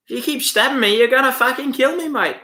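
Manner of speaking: high-pitched Australian accent impression; exaggerated Aussie protest, dropping on "mate"; Chopper. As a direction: quote it in a put-on Aussie voice. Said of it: "He's in the jail and he gets stabbed, chopper".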